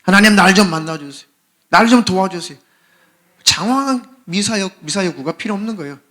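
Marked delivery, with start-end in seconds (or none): 0.0-2.6 loud, pleading cry to God; crying out in prayer. Acted. A believer crying out loud to God, pleading. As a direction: beg God loudly for rescue. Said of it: "하나님을 찾는 거예요".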